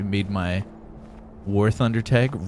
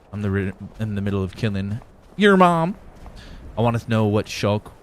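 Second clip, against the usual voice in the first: ordinary talk, nothing marked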